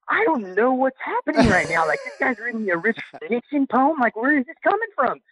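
high-pitched, baffled retelling of his own thoughts; bewildered inner monologue. Retold or imitated. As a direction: replay your disbelief at an absurd moment, pitched well up. Inner reaction to a surprise poem.

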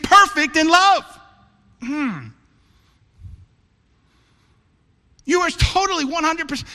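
high, loud, fervent sermon preaching; preaching at full force. Acted. Pastor challenging his church family, preaching at full force. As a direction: drive it home to the congregation. Pastor proclaiming God's love.

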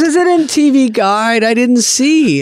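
high, fretful mock-mom voice; imitating mom. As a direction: play the worried elderly mother. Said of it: "She goes".

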